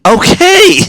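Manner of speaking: high, loud retold retort; acted-out exasperated shout; exasperated yell. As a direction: yell back, fed up.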